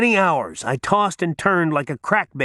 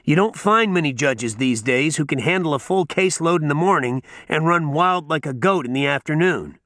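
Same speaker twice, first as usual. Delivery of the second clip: ordinary talk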